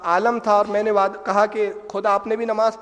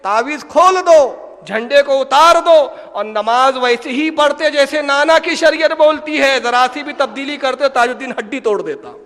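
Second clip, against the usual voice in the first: loud, higher scolding tinged with affection; loving warning; 4 semitones higher, 9 dB louder than usual. Retold or imitated. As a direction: warn them firmly but with love, much louder. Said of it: "محبت کے انداز میں اور تنبیہاً انداز".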